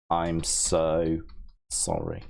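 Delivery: low, sinking fake-tearful sorry; mock tearful apology. To the chat, a hammed-up tearful apology. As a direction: pretend to be ashamed to tears. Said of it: "'cause I cried".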